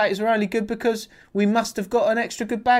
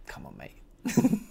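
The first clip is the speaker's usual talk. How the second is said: mock plea to mate; 6 dB quieter, much slower than usual. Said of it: "and I was always like".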